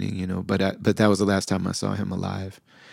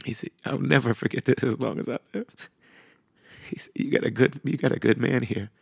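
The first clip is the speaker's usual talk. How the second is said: higher, thin voice holding back tears; choked up; 4 semitones higher than usual. Real, not acted. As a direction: struggle to get the words out, with long pauses. Overcome recalling imam's words to wife.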